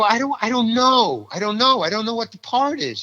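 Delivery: high-pitched, helpless protest; exasperated ignorance. Retold or imitated. Actor asked to play the guard, exasperated. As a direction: insist you have no idea, voice up high. Retold helpless answer to director.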